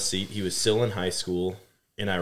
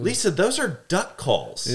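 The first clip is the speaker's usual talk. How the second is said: high, slow, pleading correction; two boys explaining to the mom in a high, exasperated voice; exasperated teen; 12 semitones higher, 4 dB louder, slower than usual. Retold or imitated. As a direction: set the confused mom straight, pitched up. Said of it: "And we're like".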